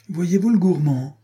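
high-pitched, jeering gibe; mocking exclamation. A narrator's reading. Marquis needling his jealous rival mid-fight, mocking. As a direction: exclaim it as a taunt. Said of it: "s'écrie le marquis, toujours prompt à l'épigramme".

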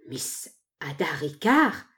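slow question rising at the end; drawn-out, incredulous query; astonished echo. A narrator's reading. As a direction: repeat the name in slow disbelief. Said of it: "pour rendre son étonnement".

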